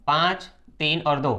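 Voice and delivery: slow, deliberate listing of पाँच, तीन और दो; slow emphasis. Shown as a demonstration. Teacher showing students the reason, slow and deliberate. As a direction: spell out each number slowly for the class. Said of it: "तो आपको कारण बताना है".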